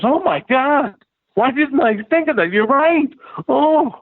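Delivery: high, gushing mock excitement; excited. Peter realizing he missed the autograph, high excited gush. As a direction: retell his giddy delight, way up high.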